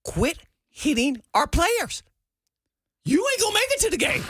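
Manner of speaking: high, emphatic imitation of an exasperated coach; retold coach's telling-off. Coach telling Blaine off before game day, mock scolding.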